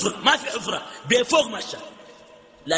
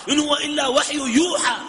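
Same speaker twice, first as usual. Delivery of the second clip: preaching shout; 7 semitones higher than usual. Real, not acted. Preacher climaxes on scripture.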